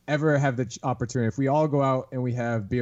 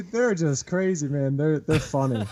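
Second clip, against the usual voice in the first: ordinary talk, nothing marked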